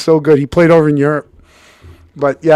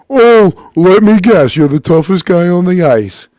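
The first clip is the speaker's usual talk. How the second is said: loud, higher-pitched sneering taunt; mocking taunt; 5 semitones higher, 6 dB louder than usual. Kid taunting an older player on the ice, mocking. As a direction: imitate a cocky challenger, loud and high.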